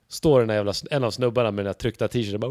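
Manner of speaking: ordinary talk, nothing marked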